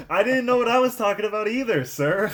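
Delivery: high, loud mocking retort, laughing at the edges; mock indignant comeback with a laugh; sarcastic reply. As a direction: sass the absent dad. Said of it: "mocking reply to dad".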